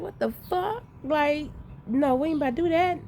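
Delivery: high-pitched, incredulous retold outburst; shocked disbelief. Mother reacting to people grabbing her child, shocked disbelief.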